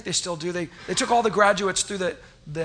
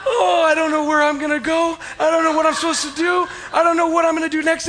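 high, loud crying wail, much higher and louder; retold sobbing; 9 semitones higher, 7 dB louder than usual. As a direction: blubber it out like a sobbing graduate. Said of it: "I just started crying".

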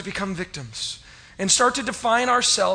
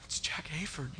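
awed hush; 4 semitones lower, 14 dB quieter than usual. Retold or imitated. Awed at meeting famous pastor.

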